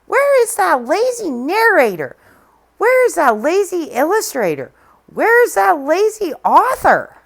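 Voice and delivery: high, loud scolding hen; fussy hen fuming at everyone; indignant hen. Acted. Little red hen scolding the lazy helpers, indignant. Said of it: "said the little red hen".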